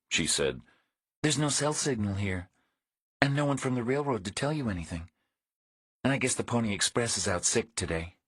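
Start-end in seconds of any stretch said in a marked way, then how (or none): 1.2-8.2 a dry, wary woman, a touch higher; woman's dry voice. A narrator's reading. Woman complains to Reacher about no signal, dry and higher.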